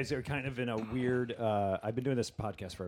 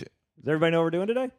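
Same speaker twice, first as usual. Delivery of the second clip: loud host call; 7 semitones higher, 6 dB louder, faster than usual. Real, not acted. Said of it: "I'm saying this loud".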